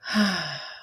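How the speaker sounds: long, easy falling "haaa"; contented "ha" sigh. Shown as a demonstration. Teacher shows the ha breath, long contented sigh. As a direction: sigh as if everything was going great. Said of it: "if everything was going great, you'd go".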